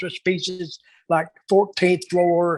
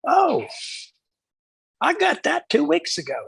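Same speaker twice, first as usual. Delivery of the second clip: much higher, breezy innocent secretary; airy secretary voice; 10 semitones higher than usual. Retold or imitated. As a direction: toss it off lightly, like it's nothing. Aubriella answering Savio, voiced in story.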